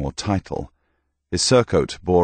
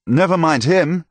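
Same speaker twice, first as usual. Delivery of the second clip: put-on much higher, louder, dismissive young noble; young blond knight dismissing Thomas, high and scornful; youthful knight; 11 semitones higher, 5 dB louder than usual. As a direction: brush him off with scorn. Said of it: "said a youthful knight with golden locks".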